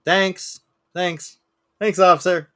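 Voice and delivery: bitter sarcastic thanks to the cop. Retold or imitated. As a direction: sneer out a fake thank-you. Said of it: "I'm like".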